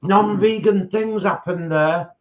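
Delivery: high, slow, whining mock complaint; whiny complainer. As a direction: moan like a petty complainer. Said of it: "some of these vegans who piss and moan about".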